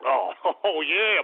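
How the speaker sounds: ordinary talk, nothing marked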